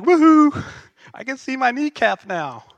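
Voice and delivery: mock cheer. Joking about his cut knee.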